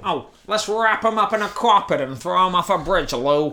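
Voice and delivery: a hint of a New York mobster accent. Joking that she sounds like a New York mobster, light accent. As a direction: play it as a light New York gangster threat. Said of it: "sounds like New York".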